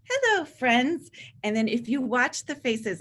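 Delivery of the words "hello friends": high, loud, slow singsong hello; imitating a kindergarten teacher's singsong welcome; kindergarten teacher greeting. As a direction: greet the little kids like a cheerful teacher. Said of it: "they say to these little people".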